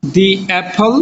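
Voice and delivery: দি Apple with "the" as "dee". Shown as a demonstration. Teacher repeating the "dee" form for students. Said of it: "উচ্চারণটা জেনে শুনে বুঝে".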